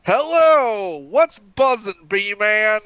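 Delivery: loud, goofy radio host greeting; hammed-up morning show shout; silly clown.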